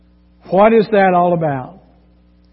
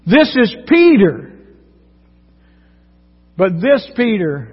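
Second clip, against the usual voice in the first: ordinary talk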